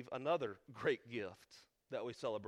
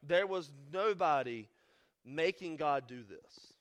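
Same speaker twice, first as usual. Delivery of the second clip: louder, firm sermon push; emphatic preaching; 4 semitones higher, 5 dB louder than usual. Acted. Pastor stressing God owed humanity nothing, emphatic preaching. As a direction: hammer each line to the congregation.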